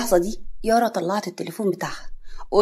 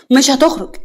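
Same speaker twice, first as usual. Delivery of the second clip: louder, higher refusal; insistent, pitched-up refusal; forceful refusal; 5 semitones higher, 7 dB louder than usual. Acted. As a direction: raise your voice to block him. Repeats refusal more forcefully.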